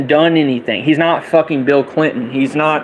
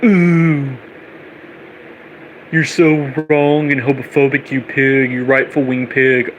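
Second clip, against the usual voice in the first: mock offended liberal.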